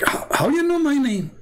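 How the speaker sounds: high-pitched imitated disbelief; mock amazement, voice soaring; astonished. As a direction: exaggerate a stunned man's question, pitch leaping up. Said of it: "the guy was astonished".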